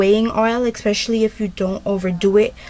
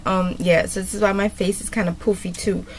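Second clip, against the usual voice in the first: ordinary talk, nothing marked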